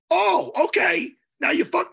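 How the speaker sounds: loud, high, slow incredulous outburst; outraged shout; outraged disbelief. Retold or imitated. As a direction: let the insult sink in, then explode. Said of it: "realizing he's mocked".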